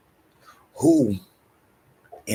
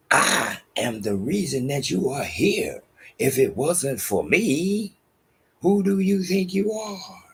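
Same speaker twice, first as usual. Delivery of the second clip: haughty, rising reproach; indignant rebuke; 9 semitones higher than usual. Retold or imitated. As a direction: put them in their place, voice climbing high at the end. Voices a scornful rebuke to children.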